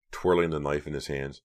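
ordinary delivery, nothing marked

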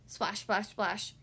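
plain reading voice

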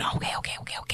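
hushed repeated whisper. Retold or imitated. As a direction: breathe it in a whisper. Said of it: "We were just going".

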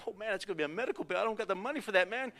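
rising comic worry over the cost; inwardly worried dad. Acted. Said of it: "Inside we're like".

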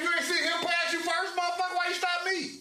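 imagined argument with a trooper, heated; mock angry argument with cop. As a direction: argue with the trooper who pulled you over. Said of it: "And I'm like".

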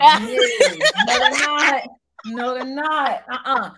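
laughing no, they're not; laughing protest. Real, not acted.

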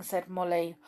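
usual speaking voice

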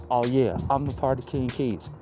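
normal talking voice

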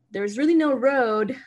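usual speaking voice

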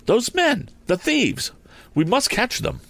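high, urgent shout; rally them to give chase; excited high cry; loud excited call. A narrator's reading. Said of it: "Tom had spoken loudly".

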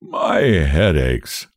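slow, low groaning complaint; low, drawn-out weary moan; tall companion. A narrator's reading. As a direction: drag it out as if nursing a headache. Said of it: "says one of the tall ones".

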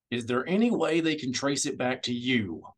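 ordinary delivery, nothing marked

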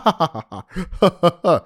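loud, high mocking laugh; long hammed-up burst of cackling laughter; imitated cackle. Host showing how this dude be acting, mock cackling. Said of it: "That's how he act. That's how this dude be acting".

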